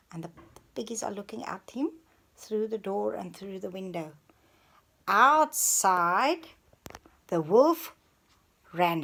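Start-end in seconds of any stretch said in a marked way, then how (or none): 0.1-4.1 quick hushed whisper. Real, not acted. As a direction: whisper it fast. Hushed aside to child.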